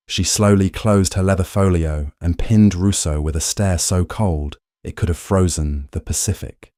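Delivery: ordinary delivery, nothing marked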